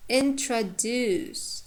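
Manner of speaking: slow "introduce" with a long "duce"; drawn-out "introduce", weighted on "duce"; stress on last syllable. Shown as a demonstration. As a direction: say "introduce" slowly, schwa in the middle, long final syllable. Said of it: "the last one is long".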